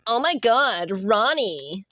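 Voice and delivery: a lightly voiced girly gush; girl voice. Joking for viewers, a hint of girl voice. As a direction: swoon a little over Ronnie. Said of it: "the frickin' girl voice".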